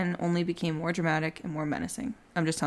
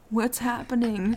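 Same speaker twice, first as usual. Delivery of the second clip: higher-pitched imitation of feigned confusion; mock dazed victim; 5 semitones higher than usual. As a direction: play-act waking up bewildered. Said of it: "was rubbing his head going".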